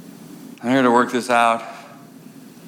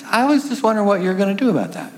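fast, higher mock-polite push; mock sweet pressing; 7 semitones higher, faster than usual. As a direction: needle him sweetly, a bit higher. Woman pressing him.

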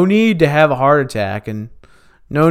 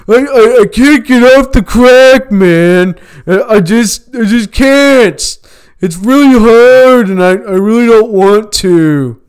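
higher and louder, a panicky jittery friend; frantic crackhead voice; 9 semitones higher, 9 dB louder than usual. Retold or imitated. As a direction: play the crackhead pleading that he has to leave, all nerves. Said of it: "He's like".

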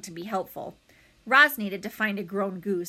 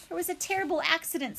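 high, quick, incongruously cheerful robot; brightly friendly, brisk delivery; 7 semitones higher, faster than usual. A narrator's reading. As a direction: report bad news in a cheery voice. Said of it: "Why does your voice sound so cheerful?".

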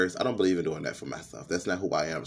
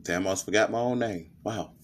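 ordinary talk

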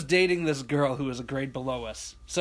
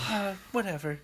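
mild dismissive whatever; 3 semitones higher, 6 dB quieter than usual. Retold or imitated. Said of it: "she's like".

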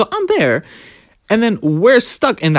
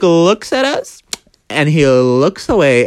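ordinary talk

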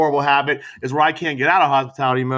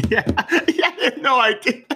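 laughing; 9 semitones higher than usual. Real, not acted. Laughs while agreeing.

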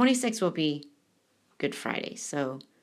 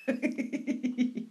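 breathy laugh; 3 semitones higher, 3 dB quieter than usual. Real, not acted. Laugh after mock wail.

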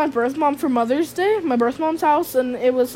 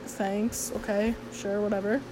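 much softer, lower, bored brush-off; unimpressed brush-off; 4 semitones lower, 13 dB quieter than usual. Retold or imitated. Answering cousin's praise, bored and dismissive. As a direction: shrug it off, barely bothering.

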